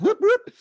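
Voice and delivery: high loud siren whoop imitated with the voice; mock siren. Imitating the cop car siren coming on, a loud high whoop.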